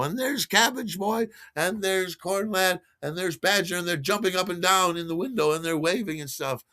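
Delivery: tripping traveller pointing at friends; excited pointing out. Retold or imitated. Tripping traveller spots friends waving outside, excited and high.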